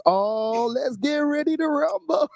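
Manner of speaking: high, slow ring-announcer drawl; a hammed-up boxing announcer build-up; Charlie Sloth announcer voice. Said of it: "'cause Charlie Sloth is like".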